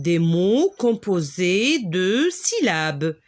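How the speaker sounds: each syllable of "Des mots composés de syllabes" spoken slowly and evenly; slow, deliberate. Shown as a demonstration. Teacher slowing down for beginners, slow and clear. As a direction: read it out slowly for pupils. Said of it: "مكونة من مقاطع صوتية".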